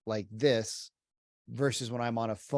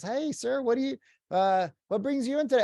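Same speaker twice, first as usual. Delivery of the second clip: mock sweet saleswoman, much higher; lightly voiced sweet store clerk, pitched way up; sweet saleslady; 11 semitones higher, 4 dB louder than usual. Store clerk greeting a shopper: high sweet voice. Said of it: "the sweet lady comes by and says".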